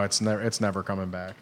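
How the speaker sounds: ordinary delivery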